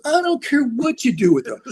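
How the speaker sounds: very high, brash mock dismissal; crass lawyer. Crass lawyer to A and R guy, brashly dismissive. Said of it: "crass dude".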